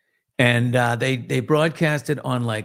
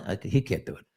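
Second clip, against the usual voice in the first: ordinary talk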